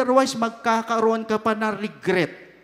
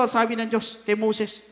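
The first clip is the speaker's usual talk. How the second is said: usual speaking voice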